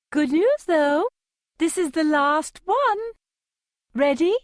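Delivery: fake-sympathetic Capitol accent, high pitched. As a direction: sympathetic Capitol stylist, high and sing-song. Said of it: "Why do these people speak in such a high pitch".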